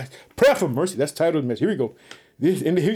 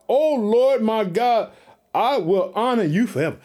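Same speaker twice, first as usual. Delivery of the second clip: fervent scripture reading; 5 semitones higher than usual. Acted. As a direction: proclaim the psalm to the congregation.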